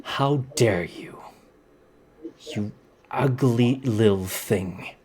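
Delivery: slow, drawn-out villainess menace; spaced-out words with controlled contempt; scowling indignation. Acted. Scowling lady answers the one who insulted her, slow indignation. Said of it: "she's still scowling".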